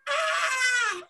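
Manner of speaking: high sudden scream. Acted. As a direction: shriek it out. Boy falls, people scream.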